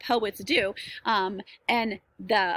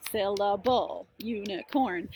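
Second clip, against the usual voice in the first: SYL-LA-BLE, U-NI-CORN broken into separate beats; syllable by syllable; slower than usual. Shown as a demonstration. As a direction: count out each beat of "syllable, unicorn". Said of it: "count syllables up your arm".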